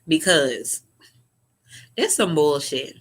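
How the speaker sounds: normal talking voice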